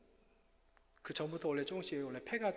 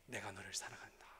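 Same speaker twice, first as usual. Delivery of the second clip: lower, unvoiced loving whisper; God's whisper; 7 semitones lower, 8 dB quieter than usual. God answering the sick pastor, lower hushed whisper. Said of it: "하나님 제게 말씀해 주신".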